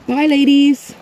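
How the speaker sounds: loud, high pet-talk farewell; high sing-song goodbye. Real, not acted. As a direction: wave the sheep off in pet-talk. Farewell to the sheep.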